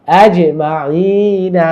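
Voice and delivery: আজ মাইনা very slow, j bounced, long "ee" held; correct recitation demo. Shown as a demonstration. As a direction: recite আজ মাইনা, echoing the j and stretching the vowel. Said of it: "শব্দগুলো শুদ্ধ করে পড়ে যাচ্ছি". (আজ মাইনা টেনে শুদ্ধ তিলাওয়াত)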